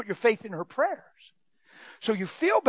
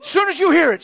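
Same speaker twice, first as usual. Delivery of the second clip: urgent preaching; 8 semitones higher, 8 dB louder than usual. Real, not acted. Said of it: "ignore it completely".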